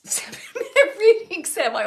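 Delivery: high, loud words breaking through laughter; laughing. Real, not acted. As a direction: laugh at yourself as you say it. Laughs at her overrunning readings.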